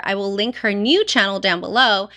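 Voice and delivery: usual speaking voice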